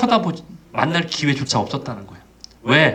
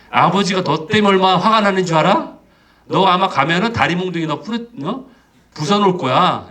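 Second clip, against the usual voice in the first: emphatic mock threat, pitched up; threatening; 3 semitones higher, 3 dB louder than usual. Older brother scaring the prodigal off, threatening. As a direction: warn him off with forceful punches. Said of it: "이렇게 아마 협박했을 거예요".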